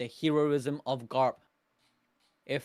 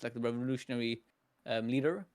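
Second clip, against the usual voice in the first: normal talking voice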